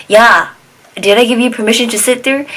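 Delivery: loud, high mock scolding; fast, indignant challenge, acted out; retold yelling. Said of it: "she started yelling at him".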